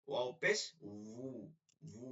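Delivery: slow, drawn ভু as "wuu"; rounded-lip wu. Shown as a demonstration. Teacher models the corrected waw for pupils, slow wuu. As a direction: round the lips and stretch ভু. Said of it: "ঠোঁট গোল করে".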